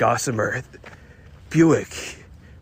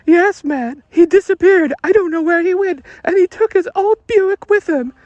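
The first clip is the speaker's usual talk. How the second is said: falsetto damsel; 9 dB louder than usual. Acted. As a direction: beg the detective in a high falsetto. Woman voice.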